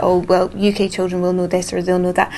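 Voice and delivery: ordinary delivery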